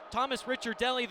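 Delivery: ordinary delivery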